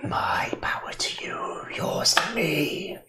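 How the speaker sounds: slow breathy whisper of a spell; whispered incantation. Acted. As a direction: hush it like a secret ritual. Incantation by character.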